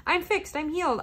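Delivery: high, mock-cheerful "all better" voice; lightly mocking a fake quick recovery; mock instant fix. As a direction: chirp it like a too-easy cure. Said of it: "hear this and be like".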